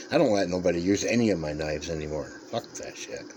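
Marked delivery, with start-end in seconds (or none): 2.5-3.2 whispered aside. Real, not acted. Muttered aside about lending knives.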